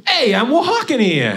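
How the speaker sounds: loud, high New York street yell; New York accent. Acted. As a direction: holler it like an indignant New Yorker in New York accent. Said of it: "to New York City".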